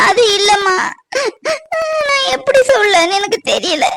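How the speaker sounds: high, choked-up crying voice; crying, unable to explain; tearful. Acted. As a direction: struggle to speak through tears.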